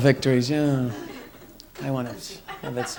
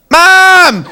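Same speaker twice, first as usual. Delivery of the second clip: high, loud yell for Mom; shouted call; 12 semitones higher, 9 dB louder than usual. Acted. Kid calling out of the bedroom to Mom, high loud yell. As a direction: holler it out in mock alarm.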